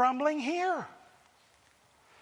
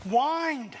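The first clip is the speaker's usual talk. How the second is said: whined drawn out with a rising-falling whine; stress on whined; 3 semitones higher than usual. Shown as a demonstration. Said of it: "or my favorite word".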